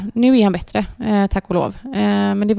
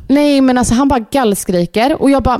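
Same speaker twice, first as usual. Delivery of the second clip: normal talking voice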